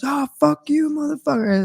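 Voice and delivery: high, mocking road-rage driver voice; angry driver. Mocking the road-rage driver yelling through the window. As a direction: mimic the raging driver squealing at you. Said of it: "And he goes".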